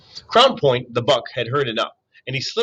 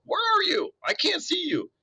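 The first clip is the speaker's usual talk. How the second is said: high little frog voice, fading softer; croaking frog; 5 dB quieter than usual. A narrator's reading. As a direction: call out like a lost frog. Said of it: "One would croak".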